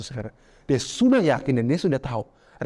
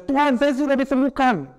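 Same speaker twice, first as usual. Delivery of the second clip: mock confident claim; 10 semitones higher, 3 dB louder than usual. Mocks sick people's confident claim.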